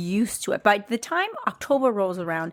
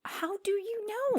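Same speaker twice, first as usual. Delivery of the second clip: mock fear; 12 semitones higher, 8 dB quieter, slower than usual. Mock fear about axe murderer.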